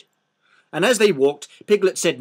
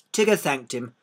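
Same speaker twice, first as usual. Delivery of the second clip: plain reading voice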